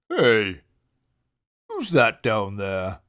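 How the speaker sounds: lightly voiced big friendly bear; deep friendly bear voice. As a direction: call down kindly from above. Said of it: "a deep and friendly voice".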